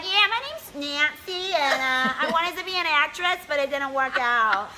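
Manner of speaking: high, slow airhead drawl; dreamy failed-actress nurse; ditzy nurse Nancy. Retold or imitated. Nurse Nancy telling the teen her backstory, high slow ditzy voice.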